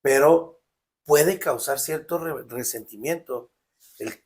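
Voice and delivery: ordinary delivery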